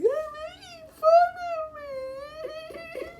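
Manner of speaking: drawn-out, squealing fake sobs; mock crying. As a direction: stretch it into a very slow, high-pitched wail. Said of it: "start crying".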